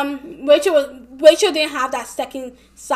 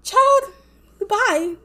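dismissive sass; 7 semitones higher, 5 dB louder than usual. Retold or imitated. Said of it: "I was just like".

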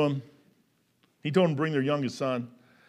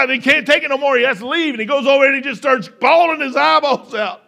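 louder, higher preacher's build; raised preaching; 9 semitones higher, 9 dB louder than usual. Acted. Preacher telling of Joseph weeping, in a louder, higher preaching voice. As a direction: drive the story home to the pews.

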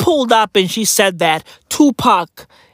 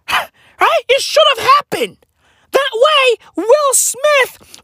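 much higher, laughing through "It should have happened". Pretend. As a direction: squeal it out in mock dismay. Laughing mock regret.

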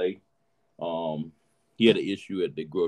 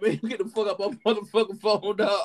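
laughing retold quote; 13 semitones higher than usual. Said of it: "I was like".

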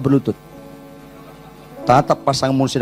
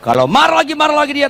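rising exclaim; 12 semitones higher, 4 dB louder, faster than usual. Retold or imitated. Wife's anger escalating.

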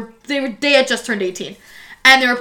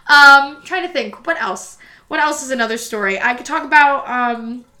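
usual speaking voice

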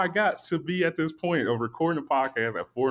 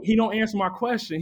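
normal talking voice

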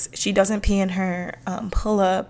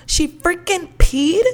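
put-on high, slow, amazed little-kid voice; toddler voice; 8 semitones higher, 5 dB louder than usual. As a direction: mimic a stunned toddler asking. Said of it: "Rumi goes".

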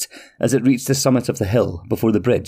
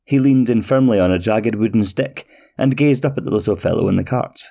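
plain reading voice

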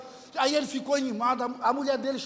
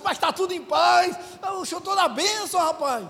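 elated insistence; 5 semitones higher, 4 dB louder than usual. Retold or imitated. Retold elated reassurance, borrowed house.

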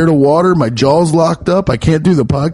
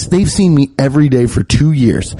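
ordinary talk, nothing marked

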